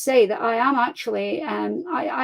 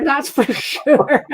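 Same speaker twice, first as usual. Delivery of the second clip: laughing; 3 semitones higher, 4 dB louder, much slower than usual. Real, not acted. Joking about ex-con character.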